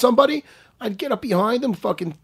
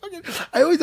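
ordinary talk

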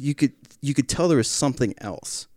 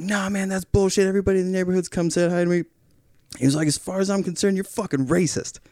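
lightly voiced indignant neighbor, pitched up; higher, mildly heated retelling; accusing neighbor; 6 semitones higher than usual. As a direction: imitate a man making an accusation. Neighbor accusing him in retold quarrel.

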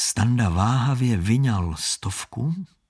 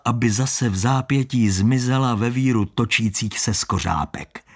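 usual speaking voice

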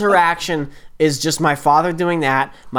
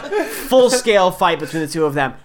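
usual speaking voice

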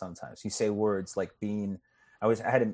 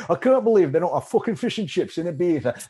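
louder, higher mock English tourist accent; English holidaymaker accent; 6 semitones higher, 4 dB louder than usual. Comic mocking Brits on vacation, English accent. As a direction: complain in a broad English accent. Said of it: "people from this country on vacation".

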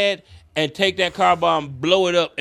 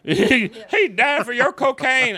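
mocking a tearful fan; 7 semitones higher than usual. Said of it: "they're like".

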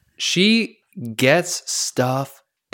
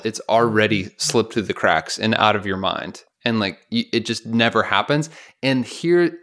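ordinary talk, nothing marked

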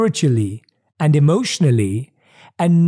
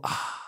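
sigh of relief; 16 dB quieter than usual. Retold or imitated. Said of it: "you feel".

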